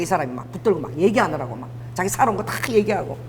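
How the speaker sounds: ordinary talk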